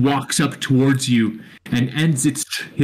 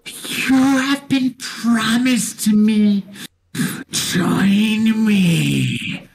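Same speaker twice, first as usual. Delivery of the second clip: high, slow otherworldly Spectre; 8 semitones higher, much slower than usual. Acted. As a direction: beckon like a ghost claiming its due. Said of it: "It says".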